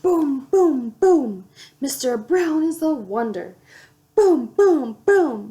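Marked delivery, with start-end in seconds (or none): 0.0-1.5 loud booming thunder. A narrator's reading. Reading to a child, loud thunder booms. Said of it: "Mister Brown makes thunder". 4.1-5.5 loud booming thunder. A narrator's reading. Reading to a child, loud thunder booms. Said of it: "Mister Brown makes thunder".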